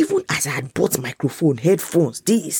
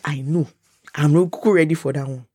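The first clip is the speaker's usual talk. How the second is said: ordinary talk, nothing marked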